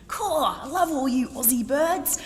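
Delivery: high, loud Cockney enthusing; Cockney geezer. Retold or imitated. Man in the Merc enthusing at the girls in Cockney. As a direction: gush it like a cheeky London bloke. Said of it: "It does involve an accent ... Cockney one".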